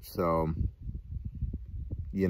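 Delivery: talking around pipe stem; pipe in mouth. Real, not acted. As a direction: lips clenched on pipe. Seen 5: Stem clenched in lips until ~2.2s, then only held near mouth.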